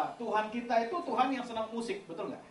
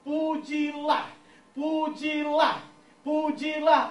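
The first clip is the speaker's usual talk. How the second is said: pujilah drawn out slow, higher and louder like an order; command emphasis; 7 semitones higher, 4 dB louder, much slower than usual. Shown as a demonstration. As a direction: stretch each pujilah as a command. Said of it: "itu artinya kalimat perintah".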